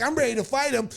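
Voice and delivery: ordinary talk, nothing marked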